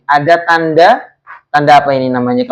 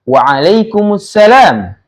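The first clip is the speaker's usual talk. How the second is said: slow, higher-pitched teacher's model, falling at the end; model reading for repetition; 4 semitones higher, slower than usual. Shown as a demonstration. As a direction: say it slowly for the class to copy. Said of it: "kalian tirukan lagi ya". (dibacakan pelan untuk ditirukan)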